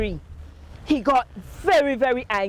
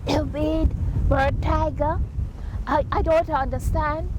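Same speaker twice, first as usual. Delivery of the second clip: feigned innocence; 5 semitones higher than usual. Acted. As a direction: play dumb with a whimper. Anansi faking innocence inside.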